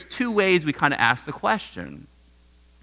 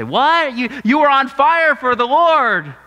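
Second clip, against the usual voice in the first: high, loud disbelieving gasp of a question; meeting an old friend who quit church, shocked; 9 semitones higher, 4 dB louder than usual. Retold or imitated. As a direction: recoil in shock at the news. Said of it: "And we kinda say".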